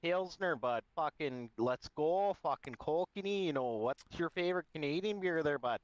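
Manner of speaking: put-on Alberta hoser accent. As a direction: play up a hockey-bro Alberta accent. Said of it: "Alberta accent".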